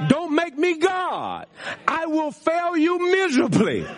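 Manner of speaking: high-pitched, drawn-out declarations with pauses; emphatic preaching. Real, not acted. Pastor telling his church not to make him God, emphatic preaching. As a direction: preach each short line high and slow.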